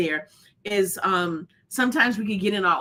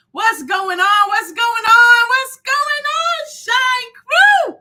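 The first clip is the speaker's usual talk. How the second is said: each call higher and louder, climbing to a shout; hyped-up shout; 11 semitones higher, 8 dB louder, slower than usual. Real, not acted. Host greeting her Shine Crew with a climbing, higher and higher shout. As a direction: pump up the crowd, building as you go.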